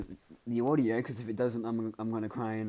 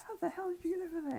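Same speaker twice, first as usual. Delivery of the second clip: very high, fast, astonished squeal; shocked girl; 3 dB quieter, faster than usual. Retold or imitated. A girl who just lost the race demands how he won, in a fast high squeal. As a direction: blurt it out in disbelief, high and quick.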